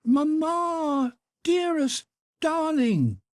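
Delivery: put-on high, delighted little boy calling out; joyful child shout. A narrator's reading. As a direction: cry out in joy like a small boy. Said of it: "he shouted again".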